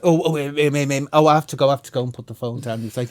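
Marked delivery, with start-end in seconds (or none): 0.0-2.0 loud, raised mock fluster; flustered imitation. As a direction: mimic someone caught out and stammering to get away, loudly. Officer flustered, caught out on phone.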